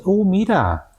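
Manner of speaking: a showy high Oh, mira; exaggerated. Shown as a demonstration. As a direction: overdo it a little, playfully. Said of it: "ya exagerando un poco". (exclamación exagerada, más aguda)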